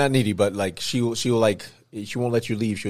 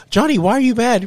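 very high, loud, phony concern; hammed-up imitation, pitched way up; mock bad actress; 13 semitones higher, 8 dB louder than usual. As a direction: overact it like a terrible actor. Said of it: "like the worst actor trying to be real".

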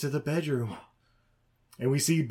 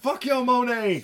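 high, loud comic taunt; mock rampage yell; 9 semitones higher, 5 dB louder than usual. Buddy ripping art off walls, hammed-up yell. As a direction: holler it as you smash things.

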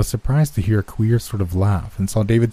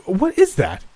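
high, startled question; terrified cry; 11 semitones higher, slower than usual. A narrator's reading. Man jumps away from unseen touch, terrified. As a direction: gasp the question out in fright. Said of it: "his face fairly distorted with terror".

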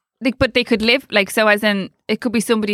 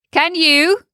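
put-on talking to a child; 7 semitones higher, 3 dB louder than usual. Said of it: "like he would speak to a child in play school".

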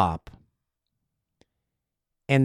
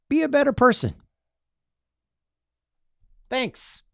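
usual speaking voice

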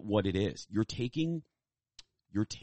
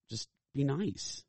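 pleading; 7 semitones higher, slower than usual. Real, not acted. Pleading to be nice to staff.

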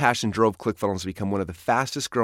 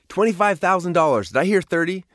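fast, higher auctioneer call; 8 semitones higher, 4 dB louder than usual. A narrator's reading. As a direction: rattle off bids like an auctioneer. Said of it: "The voice of the auctioneer was fast".

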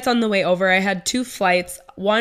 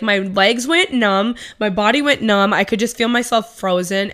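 normal talking voice

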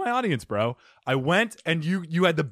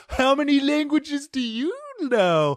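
customer voice; 10 semitones higher, slower than usual. Retold or imitated.

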